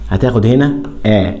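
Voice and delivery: ordinary talk, nothing marked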